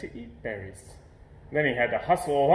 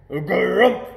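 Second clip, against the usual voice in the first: loud startled grunt; hammed-up surprised bear grunt; bear's startled grumph; 5 semitones higher, 9 dB louder than usual. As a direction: let out a choked loud grumph. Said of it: "she cried".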